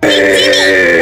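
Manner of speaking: very deep slowed voice; deep distorted voice. Acted.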